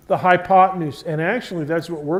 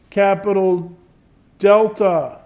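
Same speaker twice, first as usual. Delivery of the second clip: capital delta said louder, delta hit hardest; emphasis on capital delta; 5 dB louder than usual. Shown as a demonstration. Teacher to class, punching capital delta louder. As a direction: punch delta so they remember it. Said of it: "I'm emphasizing".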